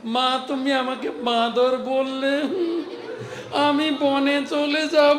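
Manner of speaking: put-on high, loud, slow wailing child's voice through tears; crying child. Little Gopal wails to his mother, high and tearful. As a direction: wail it like a sobbing little boy threatening to run away. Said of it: "কাঁদো, কাঁদো".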